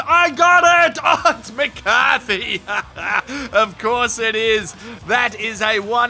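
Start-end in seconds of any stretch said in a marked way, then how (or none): 0.1-1.0 high, loud yell of "I got it"; yelled call. Retold or imitated. A player yelling to claim the mark, loud yell. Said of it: "Someone yells". 1.0-4.7 laughing through the words, slowed. Real, not acted. Commentator laughing at McCarthy's big mark, real laugh.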